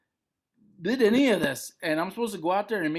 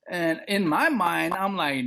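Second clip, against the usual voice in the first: ordinary talk, nothing marked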